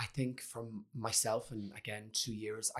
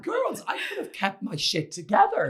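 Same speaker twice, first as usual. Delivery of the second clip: much higher, louder mock indignation; indignant protest; 10 semitones higher, 8 dB louder than usual. Telling sisters he could cope, high loud mock protest. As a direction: protest to the girls, pitched way up. Retold self-defense to sisters.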